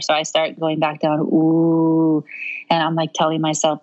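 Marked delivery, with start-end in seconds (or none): 1.2-2.2 long, steady low-pitched "ooh" moan. Retold or imitated. Labouring mother brings her moaning back down, low moan. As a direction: imitate a controlled labour moan, held level. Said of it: "take your moaning back lower".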